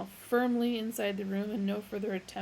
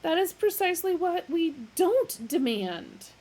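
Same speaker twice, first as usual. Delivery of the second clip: louder, much higher; irritated; 9 semitones higher, 7 dB louder than usual. A narrator's reading. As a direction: correct him sharply. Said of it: "in irritation".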